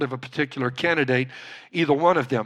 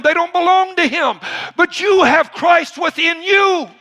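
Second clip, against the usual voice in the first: high sermon building to a shout; full-force preaching; 9 semitones higher, 5 dB louder than usual. Real, not acted. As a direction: drive the congregation toward a ringing climax.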